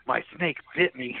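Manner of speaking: a light imitation of her thrilled phone call; excited news. Wife calling from home, excited over her snake bite. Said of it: "She was all excited".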